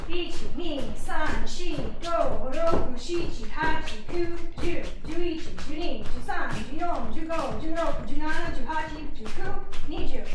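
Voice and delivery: loud Japanese rep count, called out like a drill. Real, not acted. Mom calling the exercise count in Japanese to the family, loud. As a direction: bark each number out to keep the class in time.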